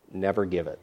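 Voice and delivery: ordinary delivery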